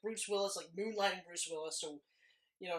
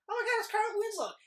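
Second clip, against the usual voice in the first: high-pitched, thrilled recognition; excited fan squeal; 13 semitones higher, 4 dB louder than usual. Retold or imitated. Fan of Family Matters spotting the actor, excited reenactment. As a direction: leap up in pitch like a starstruck fan. Retelling fan's delighted recognition.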